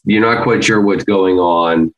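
ordinary delivery

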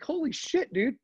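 sarcastic mock shock at the mask advice; mock amazement. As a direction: feign being stunned by the news. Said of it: "I'm like".

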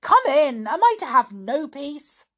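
loud, irritable bark; irritable shout. A narrator's reading. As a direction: snap it out, annoyed and loud. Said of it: "came his stepfather's irritable voice".

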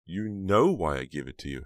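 loud, upset protest; crying out. A narrator's reading. Heather protests Snowman's accusation, crying out. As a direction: cry out in protest, voice rising. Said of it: "Heather cried".

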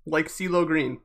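ordinary delivery

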